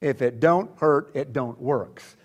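deliberate, spaced-out delivery; slow quoted saying. Retold or imitated. Preacher quotes his dad about his own body, slow and deliberate. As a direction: lay out each half slowly. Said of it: "he'll say about his own body".

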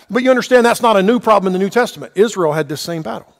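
usual speaking voice

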